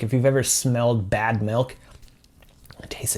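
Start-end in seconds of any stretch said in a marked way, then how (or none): 2.8-3.2 disgusted whisper. Real, not acted. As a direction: whisper it, grossed out. After spoiled milk bean.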